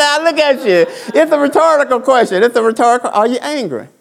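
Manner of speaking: preacher driving home the question; loud preaching. Real, not acted. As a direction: press it on the crowd. Preacher emphasising point loudly.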